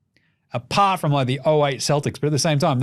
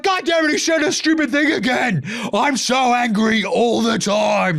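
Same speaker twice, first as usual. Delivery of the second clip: higher, put-on grumpy whine; mock angry rant; 9 semitones higher than usual. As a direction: play a hammed-up perpetually furious fan. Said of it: "Just like".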